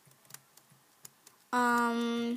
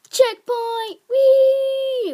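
high, loud celebratory squeal; gleeful cheer; 11 semitones higher, 9 dB louder, much slower than usual. Real, not acted. Gamer reaching a checkpoint, gleeful high cheer. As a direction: whoop it out, stretching the last sound high.

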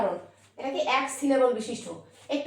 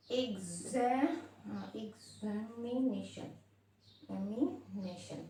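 Examination softly and slowly, piece by piece; split into syllables; 3 semitones lower, 10 dB quieter, much slower than usual. Shown as a demonstration. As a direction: sound out examination under the breath with pauses. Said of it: "তিনের অধিক syllable থাকবে". (Examination আস্তে, ভাগ ভাগ করে)

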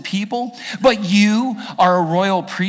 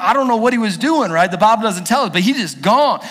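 usual speaking voice

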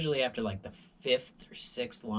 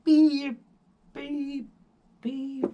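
high, loud sharp beeps; imitated alarm beep; 14 semitones higher, 8 dB louder, much slower than usual. Alarm returns after the announcement, high loud beep imitation. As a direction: beep like the alarm starting again. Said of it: "And then I get".

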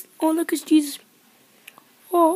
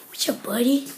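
whispered pet talk; 2 semitones lower than usual. Real, not acted. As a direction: whisper sweetly to the pup. Greeting the dog.

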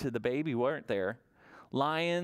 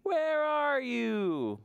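high, slow drawn-out call; mother calling; 9 semitones higher, much slower than usual. Acted. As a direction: call out for the hiding baby, stretched. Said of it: "looks by the tum-tum trees and says".

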